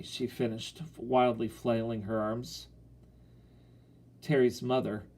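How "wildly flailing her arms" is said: ordinary delivery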